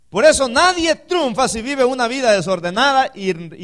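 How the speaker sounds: loud, driving sermon delivery; preaching. Acted. Pastor preaching to the congregation, driving and loud.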